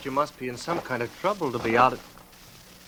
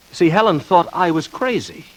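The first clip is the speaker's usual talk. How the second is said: higher and louder, sharp; sudden bitter outburst; 7 semitones higher, 7 dB louder than usual. Acted. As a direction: flare up at the memory. Bitter burst about being committed.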